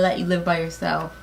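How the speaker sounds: usual speaking voice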